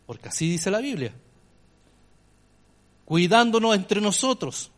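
ordinary talk, nothing marked

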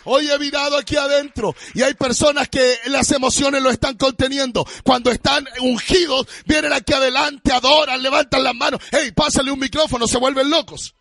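loud, rising sermon delivery; fiery preacher urging the congregation; full-force preaching. Acted. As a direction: stir the church up, pushing each line out.

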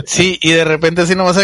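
ordinary delivery

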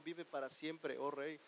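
bow to the king with a gentle voice; respectful greeting. Pretend. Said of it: "le dijo".

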